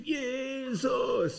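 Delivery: very high, drawn-out yell; shouted cry to God. Retold or imitated. Preacher re-enacts crying out in the woods, shouting high. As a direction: re-enact a cry at the top of your voice. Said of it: "im Wald geschrien".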